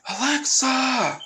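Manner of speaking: high, drawn-out, slow shout of her name; anguished call. Acted. Leon sees Alexa hurt, high slow anguished shout. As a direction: cry her name out as she falls.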